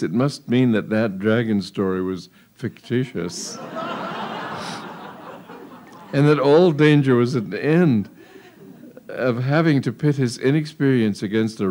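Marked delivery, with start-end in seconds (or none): 3.6-5.5 breathy high giggle; a soft unvoiced chuckle; airy laugh. Real, not acted. Narrator laughs.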